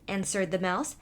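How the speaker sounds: usual speaking voice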